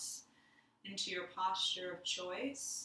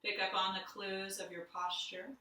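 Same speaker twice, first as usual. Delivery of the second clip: guided meditation. Acted. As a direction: lead them inward gently, letting silence sit. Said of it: "we'll do a little guided meditation".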